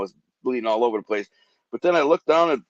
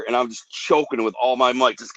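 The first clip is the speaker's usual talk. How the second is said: ordinary talk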